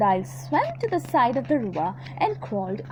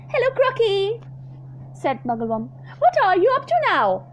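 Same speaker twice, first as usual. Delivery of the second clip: much higher, louder cheeky monkey; chirpy monkey; 7 semitones higher, 4 dB louder than usual. Acted. Monkey in a tree greeting the croc, chirpy.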